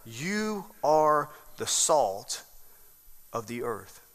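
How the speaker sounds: drawn-out, each word laid down slowly; slow solemn quote. Shown as a demonstration. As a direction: let the verse land slowly and softly. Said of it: "He says what?".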